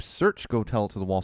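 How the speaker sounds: ordinary delivery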